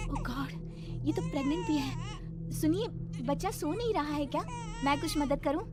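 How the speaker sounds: high, surprised then helpful voice; concerned young bahu. Acted. Priya offering the neighbour help with her baby, concerned. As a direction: call over politely in a high voice.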